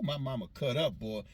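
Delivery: usual speaking voice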